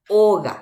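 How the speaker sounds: "Óga" with a loud first O; stress on O. Shown as a demonstration. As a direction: punch the opening O of "Óga". ("Óga" con la O inicial fuerte)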